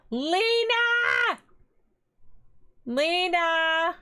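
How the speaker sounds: yelling for Lena from afar; calling out. Real, not acted. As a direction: shout her name to fetch her. Calling sister from another room.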